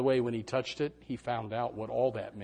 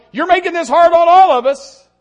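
much higher, loud fed-up parent; exasperated parent shout; 19 dB louder than usual. Retold or imitated. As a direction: shout it like you're at the end of your rope.

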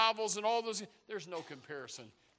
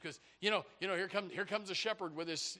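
ordinary delivery

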